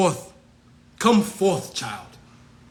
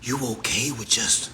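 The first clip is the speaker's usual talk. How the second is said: much lower whispered taunt; mocking whisper; 9 semitones lower, 6 dB quieter than usual. Taunting the rival brother about his price, whispered. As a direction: hush it like a secret jab.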